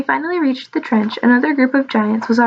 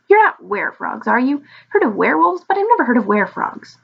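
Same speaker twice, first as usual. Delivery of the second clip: put-on higher, swooping young dragon voice; curious little dragon; 4 semitones higher than usual. Little dragon Ralph quizzes the frogs, curious wonder. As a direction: wonder aloud in a high creature voice.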